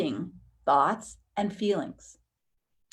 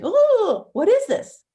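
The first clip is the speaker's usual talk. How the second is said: surprised ooh; 12 semitones higher, 8 dB louder, slower than usual. Pretend. Noticing inner misalignment, exclaiming.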